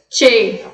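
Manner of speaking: CHE said "tchê", closed vowel. Shown as a demonstration. Teacher showing the accent keeps the sound closed, "tchê". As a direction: keep the E of Che tight, not open. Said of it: "ele vai deixar fechado".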